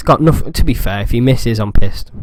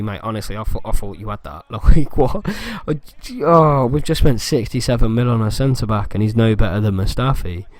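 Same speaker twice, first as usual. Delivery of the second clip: ordinary talk, nothing marked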